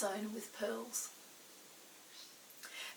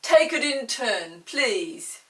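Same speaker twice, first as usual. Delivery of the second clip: slow, louder kindly magician; deep kind voice; 11 dB louder, much slower than usual. Acted. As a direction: ask slowly and kindly like a great enchanter. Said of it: "in his deep, kind voice".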